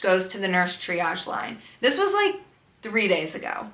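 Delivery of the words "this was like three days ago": usual speaking voice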